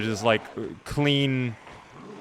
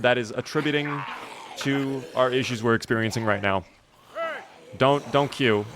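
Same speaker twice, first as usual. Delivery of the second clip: ordinary talk, nothing marked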